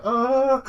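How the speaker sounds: high, loud imitated scream; mock terrified scream. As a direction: cry out like a cowering scientist. Scientist burying himself in his chair.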